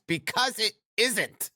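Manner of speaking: very high, slow character retort; high-voiced Achilles, insisting. A narrator's reading. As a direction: insist slowly in a high put-on voice. Narrator voices Achilles objecting.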